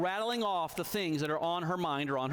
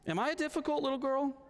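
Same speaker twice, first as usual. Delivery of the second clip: put-on higher small-girl voice, scared; a child confessing fear at bedtime; little daughter; 12 semitones higher, faster than usual.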